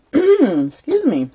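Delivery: usual speaking voice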